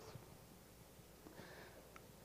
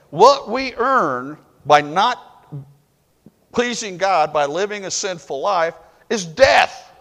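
preaching at full force; 6 semitones higher, 8 dB louder than usual. Real, not acted. Preacher driving point home.

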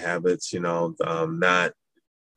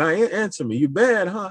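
taunting officer; 12 semitones higher than usual. Retold or imitated. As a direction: needle the recruit, pitched up. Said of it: "He said".

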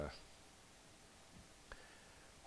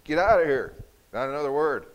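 much higher and far louder yell; furious shout; 9 semitones higher, 16 dB louder than usual. Retold or imitated. Teacher shouting the student out of class. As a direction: blast it at him, no room to argue.

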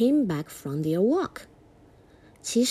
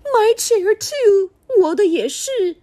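Mommy Bear voice; 11 semitones higher, 7 dB louder than usual. Acted. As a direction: stretch it out high as Mommy Bear. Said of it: "妈妈熊说".

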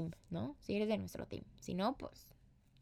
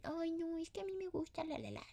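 higher, softer mock whine; whiny victim act; 8 semitones higher, 8 dB quieter than usual. Mocking someone who plays the victim, mock high whiny plea. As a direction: play the self-pitying partner who wants her way. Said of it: "te victimices y".

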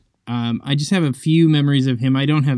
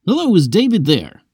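caller voice; 7 semitones higher, 4 dB louder than usual. Retold or imitated. Caller on the phone.

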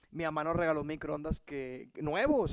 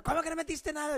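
higher accusing question; mock angry; 9 semitones higher than usual. Wife interrogating him about the microwave, mock angry. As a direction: imitate the angry wife, lightly. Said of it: "bien enojada".

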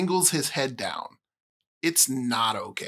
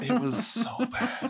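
laughing; 8 semitones higher than usual. Real, not acted. Laughing at the bad movie.

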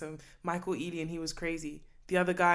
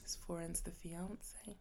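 whispering; 14 dB quieter, slower than usual. Real, not acted. Whispered intro name.

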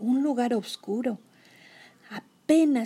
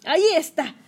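higher, louder spotting call; excited; 6 semitones higher, 6 dB louder than usual. Acted. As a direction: point it out eagerly. Kenny spots the car.

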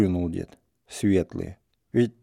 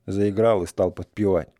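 ordinary delivery

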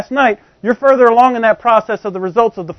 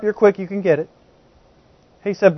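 ordinary talk, nothing marked

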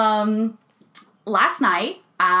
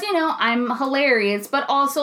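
ordinary delivery

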